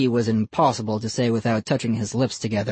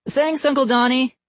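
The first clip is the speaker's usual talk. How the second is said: put-on polite young boy; bright kid thanks; 12 semitones higher than usual. A narrator's reading. Ian thanks uncle.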